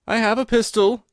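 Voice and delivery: high, loud frightened cry from the dark; reedy, quivering voice. Acted. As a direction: call out shakily, afraid of the intruders. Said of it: "a reedy, quivering voice".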